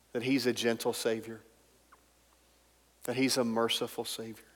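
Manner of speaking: ordinary talk, nothing marked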